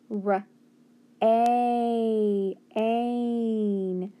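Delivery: "r-", then a long held "a", then "ain"; sounding out. Shown as a demonstration. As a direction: stretch the A of "ain" for a learner. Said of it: "but listen".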